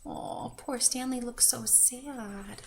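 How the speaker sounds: ordinary delivery, nothing marked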